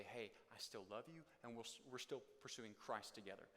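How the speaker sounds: soft, sincere reassurance; tender. Pretend. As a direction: say it gently, making peace. Goal: reconciling words to spouse.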